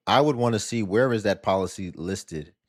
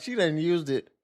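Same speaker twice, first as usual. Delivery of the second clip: ordinary delivery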